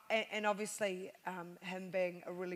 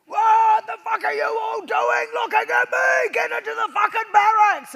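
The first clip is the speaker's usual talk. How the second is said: much higher, louder drill-sergeant bark; sergeant major shout; 13 semitones higher, 15 dB louder than usual. Retold or imitated. Sergeant major yelling at recruits off the bus, furious shout. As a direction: yell at new recruits. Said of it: "the first thing he said was like".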